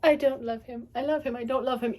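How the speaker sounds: flip-flop between love and denial, hammed up; mock melodramatic lovesick wavering; torn lover. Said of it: "she was like".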